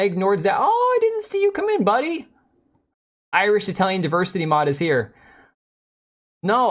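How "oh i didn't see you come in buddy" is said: much higher, hammed-up welcome voice; high put-on greeting. Streamer mock-greets a viewer who arrived, silly high voice.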